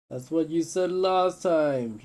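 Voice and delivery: loud, high, slow bitter shout; angry retort. Acted. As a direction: throw it back at him, furious.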